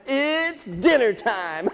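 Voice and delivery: high, drawn-out taunt; taunting rabbit. Acted. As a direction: stretch it out in a teasing high voice. Teasing rabbit in an animal tale.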